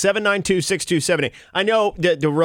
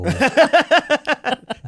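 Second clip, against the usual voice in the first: loud laugh; 11 semitones higher, 4 dB louder than usual. Real, not acted. Laughs after own fry voice.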